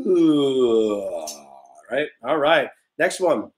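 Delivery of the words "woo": woo that slides from high down low; falling woo slide. Shown as a demonstration. Teacher throws a pretend note off a cliff, gliding down. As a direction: toss a note off a cliff and let it drop. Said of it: "High to low".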